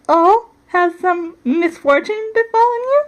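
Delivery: high, put-on polite concern; mock concern. A narrator's reading. Princess to her scolding aunt, mock concern. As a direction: feign sympathy in an overly proper voice.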